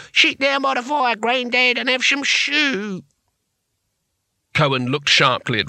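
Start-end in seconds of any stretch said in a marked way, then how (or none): 0.1-3.1 lightly voiced condescending helper, high and slow; a touch of a carer cooing at grandad; mock patronising carer. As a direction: mimic people talking down to him. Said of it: "They say".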